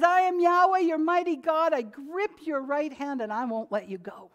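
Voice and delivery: big, high, loud preaching of the divine voice; booming pulpit proclamation; proclaiming God's words. Acted. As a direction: announce it as God declaring himself. Voicing God in scripture.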